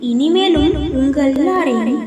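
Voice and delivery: put-on a gentle heavenly being blessing the children; the whale reveals itself as an angel, low, soft and solemn. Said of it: "நான் ஒரு தேவதை".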